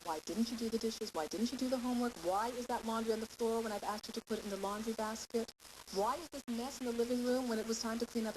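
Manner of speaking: usual speaking voice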